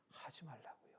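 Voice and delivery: "하지 말라고요" nearly whispered, falling at the end; hushed answer. Retold or imitated. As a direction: drop to a very soft, settled "하지 말라고요". ("하지 말라고요"를 나직이 속삭이듯)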